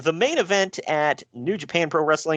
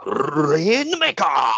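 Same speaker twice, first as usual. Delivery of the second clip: gravelly manager voice; 5 semitones higher, much slower than usual. Retold or imitated. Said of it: "like, gravelly voice".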